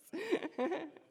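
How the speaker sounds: nervous laugh. Real, not acted. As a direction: laugh at yourself, flustered. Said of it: "I'm a bit nervous".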